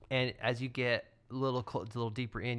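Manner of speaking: usual speaking voice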